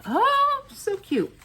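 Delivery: high-pitched squeal of delight; delighted coo. Real, not acted. Crafter delighted by her card taking shape, high coo.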